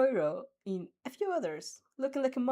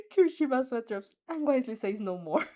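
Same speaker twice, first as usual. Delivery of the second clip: plain reading voice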